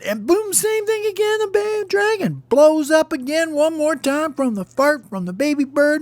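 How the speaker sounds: very high-pitched storytelling rush; thrilled, squealing storyteller; excited narration. A narrator's reading. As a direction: narrate the big blast at peak excitement. Second explosion in the story.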